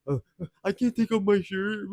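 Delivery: high, nervous stammering mock voice; mocking a timid guy too shy to undress; insecure guy. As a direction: play a flustered, insecure guy being teased. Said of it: "a lot of guys would have been insecure and been like".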